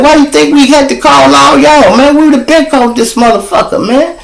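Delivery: high-pitched worked-up argument; acted-out exasperated officer; retold exasperated protest. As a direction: argue it urgently, voice way up. Retold officer arguing with commander.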